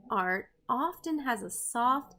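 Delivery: ordinary talk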